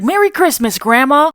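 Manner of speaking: put-on high, eager child's holiday greeting; excited grandkid. Grandkid greeting grandma at the holidays, excited. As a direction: pipe up like a grandkid.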